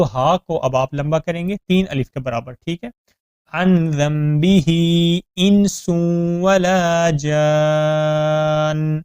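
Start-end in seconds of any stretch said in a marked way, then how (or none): 3.5-9.0 slow melodic tajweed chant; Quran recitation. Shown as a demonstration. Recitation demo.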